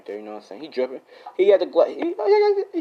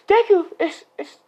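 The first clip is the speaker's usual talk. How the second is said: mock girl voice; 6 dB louder than usual.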